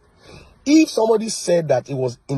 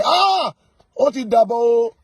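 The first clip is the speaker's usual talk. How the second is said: much higher and louder, a panicked gasp of a question; mock startled cry; 7 semitones higher, 4 dB louder, slower than usual. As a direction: yelp it out in mock alarm. Mocks person facing the trailer.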